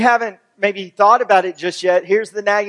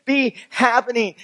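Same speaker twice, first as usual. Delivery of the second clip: higher-pitched, mildly panicked disciples; mock freak-out; 5 semitones higher than usual. Disciples react to Jesus saying he will die, mildly freaking out. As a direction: imitate disciples freaking out in disbelief. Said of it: "the disciples are freaking out".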